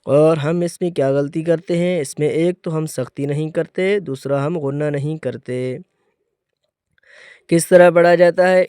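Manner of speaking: ordinary delivery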